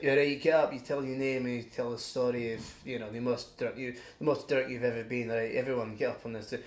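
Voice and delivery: imitated Scottish tour guide; Scottish accent. Tour guide telling passengers to introduce themselves, imitated Scottish accent. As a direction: put on a Scottish accent, low and fairly soft. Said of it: "That's quite a good accent".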